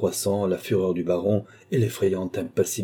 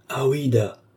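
higher-pitched scoffing exclamation; exclaiming; 5 semitones higher, slower than usual. A narrator's reading. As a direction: exclaim it as a riled old baron. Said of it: "s'écria le baron".

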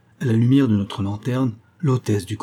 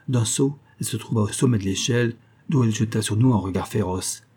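plain reading voice

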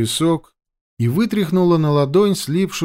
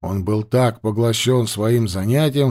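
usual speaking voice

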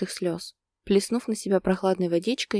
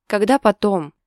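skeptical; 3 semitones higher, 8 dB louder than usual. A narrator's reading. Girlfriend questions the postponed trip, skeptical. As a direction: challenge him with open doubt. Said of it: "скептически спросила девушка".

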